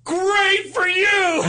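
high, drawn-out wailing yell; hammed-up anguished shout. Furious brother to the sibling who got in, wailing shout. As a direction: imitate a brother shouting in bitter despair. Brother's mock-congratulation, acted in story.

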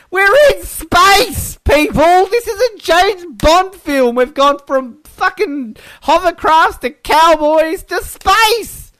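very high, slow, incredulous rant; mock exasperation. Real, not acted. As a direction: stretch it out in disbelief. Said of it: "mock exasperation".

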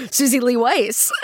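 laughing, mock-scolding full-name call; yelling child's full name. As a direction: call her full name like a mortified parent. Cringing at her own middle name.

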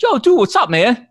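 high, excited Boston townie greeting; imitated Boston barfly. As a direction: hail a drinking buddy in mock Boston. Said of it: "there's this guy".

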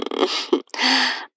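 a short high teasing giggle; flirty laugh. Acted. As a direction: giggle playfully before the question. Flirty reply in online chat.

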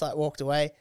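normal talking voice

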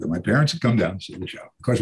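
normal talking voice

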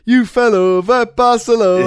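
high, loud sing-song tease; teasing sing-song. Retold or imitated. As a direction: chant it at a fallen kid. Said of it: "I'd do the classic of".

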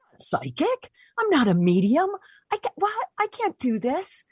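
high-pitched retold panic; incredulous protest. Author doubting she can channel the book, high-pitched protest. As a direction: protest in a squeaky, overwhelmed pitch. Retells own self-doubt after session.

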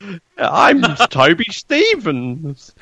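slow, lightly voiced villain, laughing; a hint of the villain, drawn out; mock Toby Stevens. Host pretends to be Kim Jong-un as the villain, slow and light. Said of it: "show up as Toby Stevens".

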